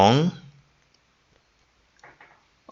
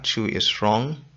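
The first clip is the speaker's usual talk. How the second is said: usual speaking voice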